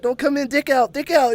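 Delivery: high-pitched, imitated urgent call; frantic warning. Kid warning mom outside the door, retold frantic warning. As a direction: warn her off in mock panic.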